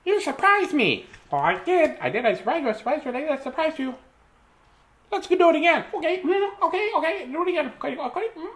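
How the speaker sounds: very high squirrel voice; squeaky, giddy squirrel chatter; excited squirrel. Acted. Squirrels eager to do it again, high excited voice.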